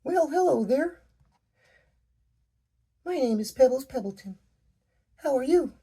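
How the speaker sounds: put-on very high, bright childlike voice with a tremble; a small rock girl greeting a giant in awe; little girl Pebbles. A narrator's reading. Child speaking up to a giant stranger, high and trembling. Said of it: "Pebbles' voice trembled".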